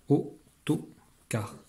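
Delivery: "autocar" very slowly, au, to, car with gaps; split into syllables. Shown as a demonstration. Teacher cutting the word into syllables, slow and separate. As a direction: break "autocar" into three separate beats. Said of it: "les syllabes sont les morceaux de mots".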